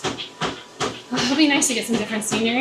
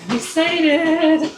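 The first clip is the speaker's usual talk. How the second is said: excited; 6 semitones higher, 4 dB louder, much slower than usual. Real, not acted. Drawn-out gush about the race.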